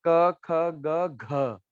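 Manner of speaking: क, ख, ग spelled out very slowly; slow recital. Shown as a demonstration. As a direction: count the letters out one by one. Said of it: "ग के बाद क्या आता है". (क, ख, ग बहुत धीरे, एक एक करके)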